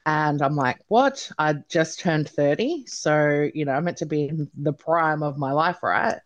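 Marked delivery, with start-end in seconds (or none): 0.9-1.3 high, rising "what?" of disbelief; shocked disbelief. Retold or imitated. Patient retelling her reaction to a stroke diagnosis, shocked disbelief. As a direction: replay being caught completely off guard.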